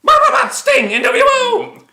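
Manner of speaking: very high, loud mocking shriek; mocking squeal. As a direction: shriek it like an excited fanboy. Mocking tweet.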